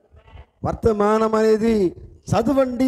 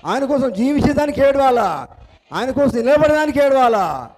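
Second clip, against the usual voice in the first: raised, insistent preaching refrain; impassioned preaching; 3 semitones higher than usual. Real, not acted. Preacher challenging the congregation on what to weep for, impassioned. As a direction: drive each rhetorical question home, pitched up and falling at the end.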